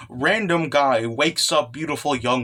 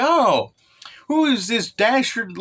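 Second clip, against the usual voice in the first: high theatrical voice; 7 semitones higher, slower than usual. Retold or imitated. Stranger voiced spotting crying Emperor.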